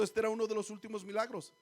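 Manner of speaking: usual speaking voice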